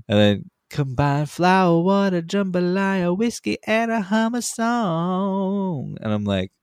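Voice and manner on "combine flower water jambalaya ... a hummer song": high, slow mock Southern drawl. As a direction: stretch it out like a hammy Southerner. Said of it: "he goes full, like, Southern drawl".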